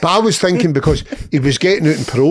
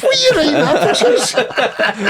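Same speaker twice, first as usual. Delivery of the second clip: much higher, stunned disbelief; shocked squeal; 11 semitones higher than usual. Retold or imitated. To cellmate about his crude letter, shocked high squeal. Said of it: "And I was like".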